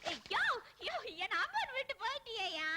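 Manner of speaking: mock wailing.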